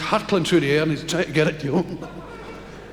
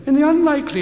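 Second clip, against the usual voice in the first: announcement voice; 10 semitones higher, 4 dB louder, faster than usual. Retold or imitated. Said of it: "they always say that when you're in airplanes".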